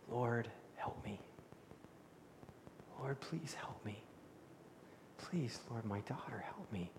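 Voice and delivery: hushed, low, nearly whispered plea; begging. Acted. Desperate mother kneels before Jesus for her daughter, begging very quietly. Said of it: "she begins to beg".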